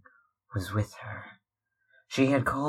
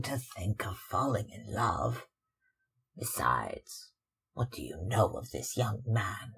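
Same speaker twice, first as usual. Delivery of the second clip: worried mother; 6 dB quieter than usual. Acted. Mother answers her daughter.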